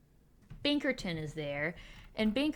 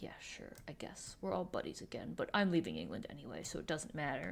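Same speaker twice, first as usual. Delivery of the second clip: much softer, resigned retold reply; half-hearted agreement; 3 semitones lower, 6 dB quieter, faster than usual. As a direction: imitate a grudging, muted concession. Acts out Duke's reluctant reply.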